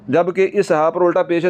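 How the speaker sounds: ordinary talk, nothing marked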